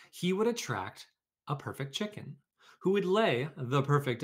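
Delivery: lively read-aloud storytelling voice; story narration. A narrator's reading. Teacher reading a picture book to her class, lively storytelling.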